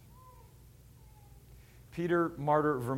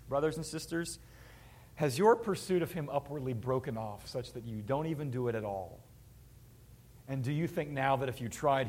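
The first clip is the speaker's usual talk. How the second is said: ordinary talk, nothing marked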